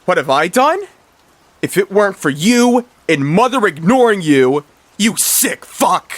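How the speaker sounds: high, loud voiced villain fish spitting accusations; bitter rage. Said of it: "Sir Raporg speaks again".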